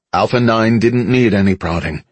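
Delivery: ordinary delivery, nothing marked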